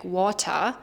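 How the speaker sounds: "water" in a German accent, final R dropped; German-accented pronunciation. Shown as a demonstration. Teacher showing German learners' habit, German-accented "water" without R. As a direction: say "water" German-style, ending in an open "uh". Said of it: "if you have a German accent".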